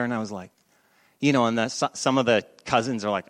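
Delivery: ordinary delivery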